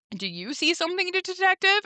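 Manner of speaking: mocking kid attitude. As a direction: tease him back, mimicking his own question. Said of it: "the kid gives him a lot of attitude".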